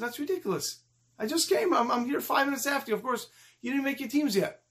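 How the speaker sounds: high-pitched, put-out schoolboy arguing; acted childish outrage, not shouted; indignant protest. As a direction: argue it like a kid being treated unfairly. Boy argues against being excluded.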